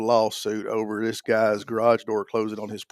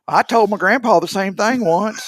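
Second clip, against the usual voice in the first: random commenter; 9 semitones higher, 5 dB louder than usual. Retold or imitated. Reading a random comment aloud.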